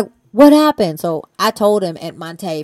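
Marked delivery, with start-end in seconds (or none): 0.3-1.0 higher, alarmed question; worried sudden ask. Retold or imitated. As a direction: catch the shock of bad news. Friend asks in alarm.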